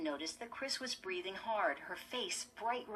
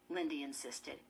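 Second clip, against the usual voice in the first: plain reading voice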